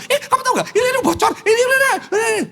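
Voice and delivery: very high-pitched mock nagging, tumbling into a rapid jabber; nagging wife. Wife pouncing on husband just home, high frantic nagging. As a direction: pester him in a put-on high wife's voice.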